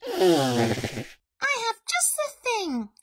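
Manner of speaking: very high little mouse voice; chirpy storybook mouse; too-cheerful mouse. A narrator's reading. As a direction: reassure with relentless cheer, pitched high. Mouse offers another remedy.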